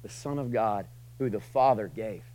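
usual speaking voice

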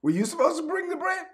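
high squeaky mock accusation; bickering disciple. Pastor voicing a disciple blaming Andrew, squeaky high. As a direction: pitch it way up like a whiny argument. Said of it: "So they're like".

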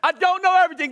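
high-pitched emphatic declaration; like you mean it. Shown as a demonstration. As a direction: belt it out to rally the room. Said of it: "say it like you mean it".

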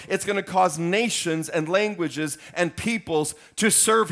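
loud, high preaching push; full-force preaching. Acted. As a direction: preach it out over the crowd.

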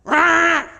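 loud high hammed-up child's frustrated yell; mock tantrum roar. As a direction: roar like a furious toddler. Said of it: "kicking, screaming".

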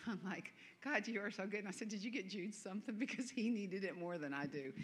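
ordinary delivery

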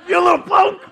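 high, loud comic outburst; hammed-up yell at a cheeky kid; mock outrage. Mock inner outburst at driver.

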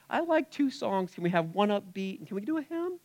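mock a picky churchgoer; whiny churchgoer. Churchgoer demanding songs, lightly whiny mock voice.

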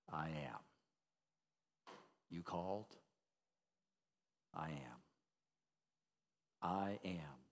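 a soft murmur close to the ear; still small voice. Acted. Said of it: "he whispers ... still small voice".

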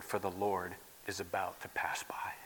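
hushed, secret whisper. Acted. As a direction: drop to a whisper for the Lord. God's words hushed.

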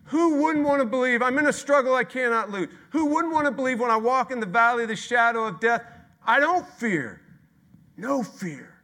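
high, loud preacher's cry; loud pleading shout; full-force preaching. Real, not acted. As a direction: lift it to a ringing shout. Preacher at full force, rising appeal.

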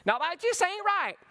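mock down-south hillbilly accent; Southern hillbilly accent. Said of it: "because we say things like".

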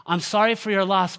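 over-eager acted condolence; uncomfortable condolence. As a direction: imitate a well-meaning consoler pushing on. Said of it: "because we're so uncomfortable with this, we will say things like".

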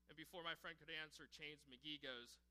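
usual speaking voice

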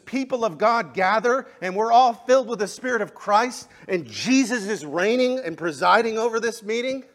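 loud, high, fervent preaching; shouting sermon with passion; full-force preaching. Acted. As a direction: lift the room with fiery conviction.

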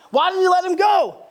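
usual speaking voice

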